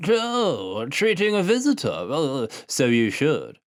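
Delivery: put-on high, slow old man voice; old farmer teasing. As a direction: tease the boy, drawn out.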